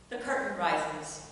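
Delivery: ordinary talk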